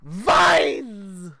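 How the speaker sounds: high, loud grandma yell through laughter; hammed-up granny battle cry; Grandma shouting Vines. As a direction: belt it out like a wild grandma. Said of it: "imagine just Grandma just like".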